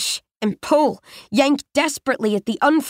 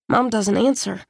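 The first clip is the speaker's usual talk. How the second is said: ordinary delivery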